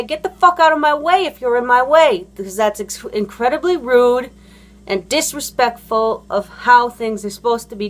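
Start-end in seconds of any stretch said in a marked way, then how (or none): 0.0-2.3 higher, heated demand; angry. Acted. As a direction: come at them angrily, acted out. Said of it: "come at you angrily and say".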